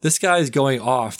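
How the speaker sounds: usual speaking voice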